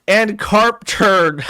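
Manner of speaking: high, loud, slow put-on voice; goofy streamer voice. Voicing Worski's nonsense line from the AI script. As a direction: drag it out in a silly voice.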